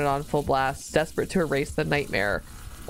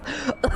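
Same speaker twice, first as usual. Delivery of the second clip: high giggle; 10 semitones higher than usual. Real, not acted. Reacting to gross story scene.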